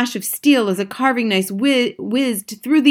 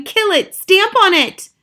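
high, loud urgent commands; shouting; 10 semitones higher, 6 dB louder, slower than usual. A narrator's reading. Cooks hunt the mouse with boots, shouting. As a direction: egg the hunters on at a yell. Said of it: "they were shouting".

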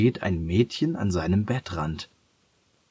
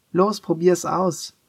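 much higher, slow, coaxing; coaxing girl voice; 10 semitones higher than usual. A narrator's reading. Mia urges hesitant Leo to try floating, high coaxing voice. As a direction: egg him on like an eager little girl.

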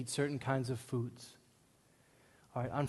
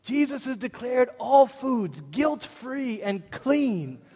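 high, loud, slow outraged yell; outraged shout at a juice ad; yelling in car; 8 semitones higher, 8 dB louder, much slower than usual. Retold or imitated. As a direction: shout down a lying billboard. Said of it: "I just started yelling in my car".

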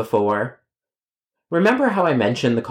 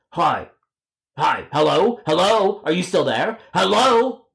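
calling out; 6 semitones higher, slower than usual. Acted. Calling on a silent line.

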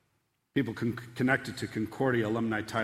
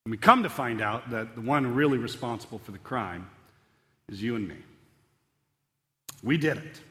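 ordinary delivery

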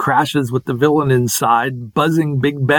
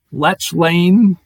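normal talking voice

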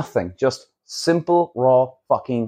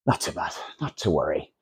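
breathy whispered shrug; whispered aside; 10 semitones lower, 8 dB quieter than usual. Real, not acted. Brushing off the missed rain in a whisper. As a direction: let it go in a whisper.

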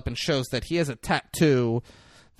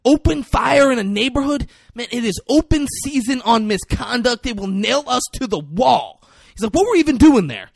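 much higher, louder frantic protest; panicking rookie; 9 semitones higher, 8 dB louder than usual. Retold or imitated. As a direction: complain in rising alarm. Ethan Hawke's character panicking.

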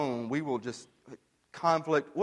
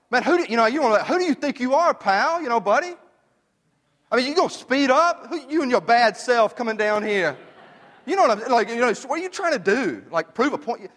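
higher, louder, worked-up rant at the driver; riled-up rant; 9 semitones higher, 5 dB louder than usual. Retold or imitated. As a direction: tell off the reckless driver, fired up. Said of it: "I was riled up".

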